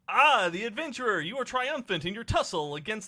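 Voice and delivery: high, loud, lilting game character. Acted. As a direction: hail the adventurer high and grandly. Reads excited game character line.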